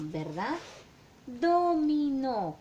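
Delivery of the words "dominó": dominó said slowly and loudly, each syllable do-mi-nó laid out; drawn-out for class. Shown as a demonstration. Teacher models the word dominó for the class, slow and loud. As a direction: spell out dominó with your voice for the kids. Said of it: "Con qué sílaba empieza dominó".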